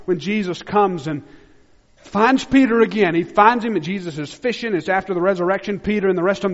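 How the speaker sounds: loud, high, ringing pulpit voice; full-force preaching. Acted. Pastor preaching hard to his church, loud and high. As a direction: preach it out with fire to the congregation.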